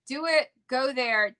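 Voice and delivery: ordinary talk, nothing marked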